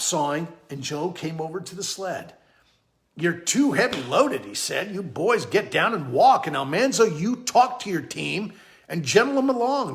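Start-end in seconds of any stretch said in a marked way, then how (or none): 3.2-4.4 loud, emphatic telling-off; firm, raised instruction; scolding older hand. Acted. Joe scolds the boy. 4.9-9.9 loud, emphatic instructions; raised voice, giving orders; scolding older hand. Acted. Joe scolds the boy.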